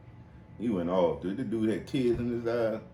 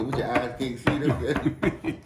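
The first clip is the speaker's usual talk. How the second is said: slow teasing; 3 semitones higher, 4 dB louder, much slower than usual. Retold or imitated. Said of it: "I said".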